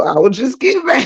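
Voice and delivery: normal talking voice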